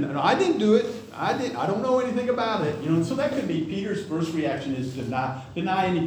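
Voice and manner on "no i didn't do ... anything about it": put-on higher, protesting child's voice; defensive kid's denial. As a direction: protest innocence like a caught kid. Kids denying they broke something.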